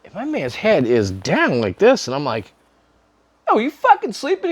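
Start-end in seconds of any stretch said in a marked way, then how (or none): 3.5-4.5 high, alarmed retold call; high-pitched call to the dozing friend. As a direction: snap him awake with the question. Friend passed out in car.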